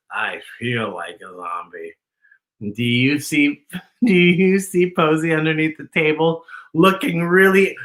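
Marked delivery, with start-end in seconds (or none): 0.1-1.9 groggy zombie dad. Acted. Tired Daddy answers Mom.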